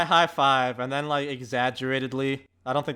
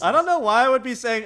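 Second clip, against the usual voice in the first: ordinary delivery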